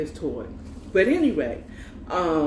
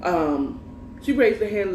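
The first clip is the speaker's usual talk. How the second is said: ordinary talk, nothing marked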